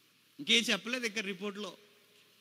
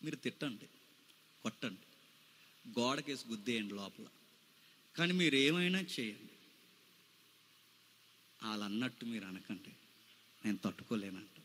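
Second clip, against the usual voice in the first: hushed wounded plea; 8 semitones lower, 11 dB quieter than usual. Retold or imitated. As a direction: plead softly to the husband, hurt. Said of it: "ఆవిడేమంటదంటే". (భర్తతో భార్య మెల్లగా బాధగా వేడుకోలు)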